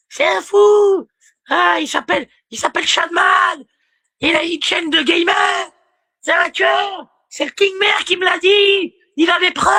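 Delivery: high-pitched mock screaming; hurlement imité. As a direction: yell it like a hysterical accuser. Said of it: "Elle lui hurlait comme". (imitation criée, voix suraiguë)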